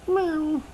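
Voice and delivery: high, plaintive mock meow; imitating a crying cat. Cat crying on a branch as dad climbs up, high mock meow. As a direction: wail like a scared cat on a branch. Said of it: "Still crying".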